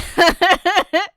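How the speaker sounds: high, loud laughing shout; loud burst of laughter. Real, not acted. Streamer laughing at the missing persons joke, loud burst. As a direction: crack up at your own joke.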